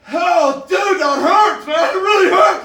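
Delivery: loud, high cry of pain; hammed-up hurting lion; pained yell. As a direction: howl it like a bitten lion. Lion hurt by bite.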